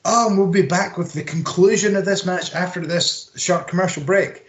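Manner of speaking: a hint of a commentator throwing to a break; Excalibur. Co-host quoting the commentator before the ad break, mock announcer voice. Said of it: "Excalibur was like".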